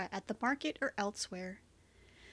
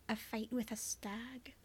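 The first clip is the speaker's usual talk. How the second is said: whispering; 9 dB quieter, slower than usual. Acted. Tender bedside talk, hushed.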